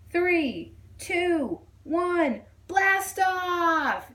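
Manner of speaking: put-on high, eager child's countdown; boy counting a rocket launch; excited countdown. A narrator's reading. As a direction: count down to liftoff like a kid.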